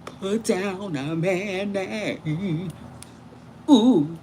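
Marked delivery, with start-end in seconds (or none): 0.1-2.7 imitating him. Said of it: "he was like".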